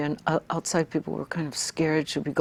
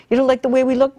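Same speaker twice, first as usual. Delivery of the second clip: much higher, louder peak of the retold rant; retold outburst; 9 semitones higher, 8 dB louder than usual. To critics who wanted Hawaiians silent, a high loud outburst. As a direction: let the last get out ring out, high and loud.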